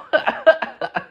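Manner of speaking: high, voiceless burst of laughter. Real, not acted. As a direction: crack up at your own punchline. Laughs at his own punchline.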